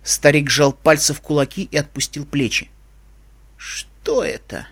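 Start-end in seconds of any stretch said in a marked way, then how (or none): 3.6-4.7 very slow, hushed question; slow and quiet, stretching the first word. A narrator's reading. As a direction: draw the words right out, softly. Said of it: "медленно спросил он".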